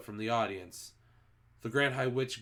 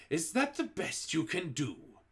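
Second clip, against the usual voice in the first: higher, scornful witch voice; Grand High Witch scolding; 6 semitones higher than usual. A narrator's reading. Grand High Witch scorning the assembled witches, higher sneering voice. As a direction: sneer at the feeble audience.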